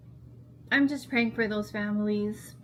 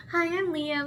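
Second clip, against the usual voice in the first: lightly voiced chipper boy, pitched up; chipper son's intro; 7 semitones higher than usual. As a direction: imitate the son's cheerful hello. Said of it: "so sweet and so chipper".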